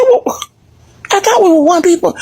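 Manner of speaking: pitched well up, wide-eyed and hurt; mock naive voice. Retelling his reply to Jamaicans, high and mock innocent. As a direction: play the innocent newcomer, voice up high. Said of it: "I said".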